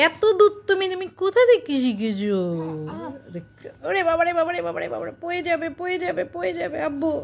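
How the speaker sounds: mock fright, fast and high. Real, not acted. Baby about to fall. (বাচ্চাকে আদুরে ভয়ের সুরে)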